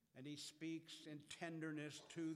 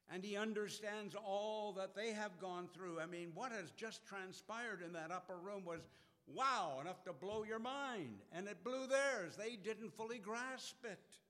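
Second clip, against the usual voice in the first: higher and louder with mounting zeal; building fervour; 7 semitones higher, 6 dB louder than usual. Real, not acted. Preacher stirring the congregation, rising fervour. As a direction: build the excitement.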